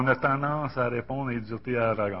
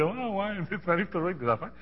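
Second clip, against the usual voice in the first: higher, fast teasing voice; teasing friend; 7 semitones higher, 5 dB louder than usual. Retold or imitated. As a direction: rib him quickly, pitched up. Friend quoted teasing him. (ami taquin, voix plus aiguë et rapide)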